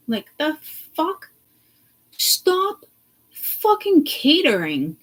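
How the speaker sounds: high, slow, exasperated outburst; incredulous angry rant; outraged. Real, not acted. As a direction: spit it out in disbelief.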